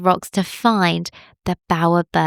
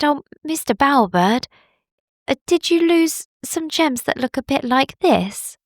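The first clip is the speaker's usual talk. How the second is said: lightly raised gentle bunny voice; Billy bunny voice; 6 semitones higher than usual. Acted. Bunny offering the bird its gems, gently raised voice. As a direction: offer the jar kindly.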